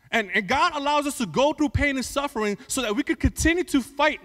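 loud building sermon voice; rising fervent preacher; preaching at full force. Acted.

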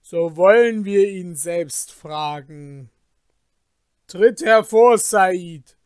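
loud, ringing summons; commanding call. Acted. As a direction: call the hidden witness forward with authority. Kalif ruft Said herbei.

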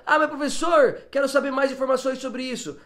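high-pitched pretend student question; imitated student. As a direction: pitch it up as an eager-to-know pupil.

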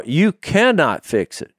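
ordinary talk, nothing marked

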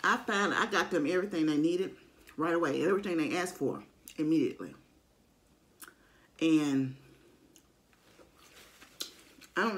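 chewing while talking; eating between words; mouth partly full. Real, not acted. Seen 2: bit the taco just before; talks with food, jaw working.

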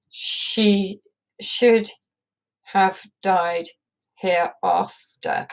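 slowed down hereafter with long gaps; slow with pauses. Shown as a demonstration. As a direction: let the missing beats hang in silence. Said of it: "another three or four missing beats".

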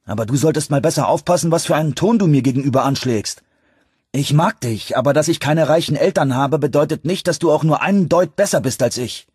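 high, rapid, heated telling-off; angry rebuke. A narrator's reading. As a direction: put him firmly in his place. Angry rebuke after slapping his friend. (aufgebrachte, scharfe Zurechtweisung)

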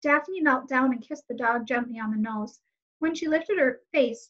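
ordinary delivery

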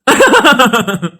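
short run of ha-ha laughter; laughing. Acted. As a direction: break into a quick wordless laugh. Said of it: "என்னடா சிரிக்கிற?".

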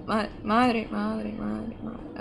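very soft, fading repetition; real hushed in-game fretting; tense muttering. Real, not acted. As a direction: mutter it under your breath, dying away. Tense muttering during gameplay.

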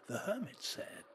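ordinary delivery

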